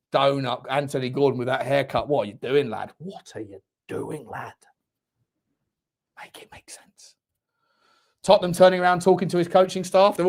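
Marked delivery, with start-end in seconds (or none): 3.0-4.5 slow, very low and soft; hushed disbelief. Real, not acted. Commentator to player over his haircut, hushed disbelief. 6.2-7.1 barely audible whisper; whispered disbelief. Real, not acted. Commentator baffled by a player's haircut, whispered disbelief.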